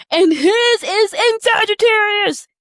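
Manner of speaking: high, loud and drawn-out reveal; big excited reveal about her brother. Real, not acted. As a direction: exclaim it, thrilled by the discovery.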